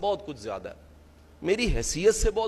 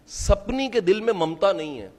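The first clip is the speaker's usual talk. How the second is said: normal talking voice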